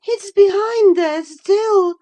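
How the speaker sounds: high, loud, frightened voice; fearful of the shape in the glass; anxious. A narrator's reading. Catherine afraid of a face in the mirror, high and frightened. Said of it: "she pursued anxiously".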